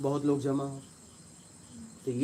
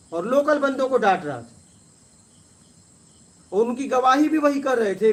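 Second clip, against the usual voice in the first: proud insistence; 7 semitones higher, 5 dB louder than usual. Real, not acted. Proud telling of his honesty.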